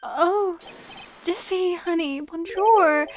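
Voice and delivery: sweet-talking mother voice; doting French mother. Acted. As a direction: coo over her darling like a fussy mom. Doting mother greets with French words.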